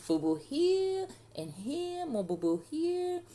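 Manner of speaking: put-on high, drawn-out little-boy whine; whining toddler. A narrator's reading. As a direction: point out each hurt slowly in a tiny high voice. Toddler showing his wounds.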